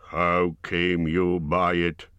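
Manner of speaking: plain reading voice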